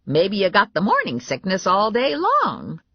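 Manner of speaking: high grandma voice; blunt grandma. A narrator's reading. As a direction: butt in like an outspoken granny.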